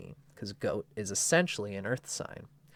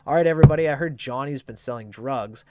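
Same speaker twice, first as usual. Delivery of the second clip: much louder announcement to the tenants; hushed no more, call the house to order; mock stern landlord call; 3 semitones higher, 9 dB louder, faster than usual.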